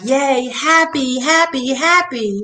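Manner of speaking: high, slow sing-song happy; exaggerated gleeful cheer; mock cheer. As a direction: stretch out each happy.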